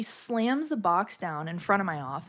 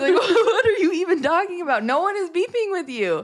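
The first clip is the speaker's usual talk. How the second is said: loud, high-pitched incredulous laughing; laughing disbelief; 10 semitones higher, 6 dB louder than usual. Retold or imitated. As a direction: retell your baffled comeback through laughter. Incredulous reply retold amid laughter.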